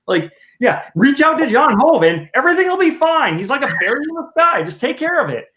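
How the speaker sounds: mock the advice in a high, airy tone; sarcastic mock. Husband mocking the clerks' advice about the senator, sarcastic high voice.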